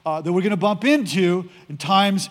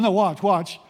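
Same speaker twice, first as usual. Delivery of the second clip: normal talking voice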